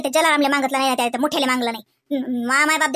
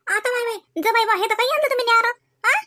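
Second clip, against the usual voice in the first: mock exclamation; 6 semitones higher than usual. Mother-in-law mock exclaims.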